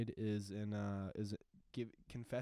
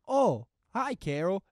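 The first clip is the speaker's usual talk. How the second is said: loud, high Boston greeting; Boston accent; 9 semitones higher, 13 dB louder, slower than usual. Retold or imitated. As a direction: hail her in a broad Boston accent. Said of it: "in a Boston accent".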